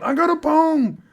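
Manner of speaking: slow, high holler imitating an insistent drunk; loud imitated yelling at security; drunken fan yelling. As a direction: stretch each shout out, pleading loudly. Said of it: "he starts yelling".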